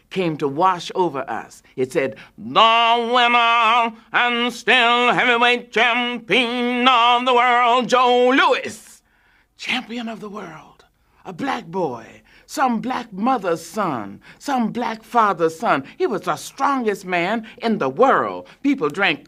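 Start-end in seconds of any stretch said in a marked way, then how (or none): 2.4-8.9 slow, high and loud proclamation; grand slow announcement of Joe Louis; ring announcer. A narrator's reading. 9.6-15.8 awed hush. A narrator's reading. Narrator echoes in awe.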